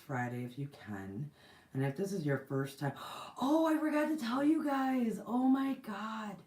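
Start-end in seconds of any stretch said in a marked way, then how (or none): 3.2-6.4 gasping, high and loud realization; sudden oh-no gasp, pitched up. Real, not acted. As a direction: gasp as it hits you that you forgot. Suddenly remembers forgotten news.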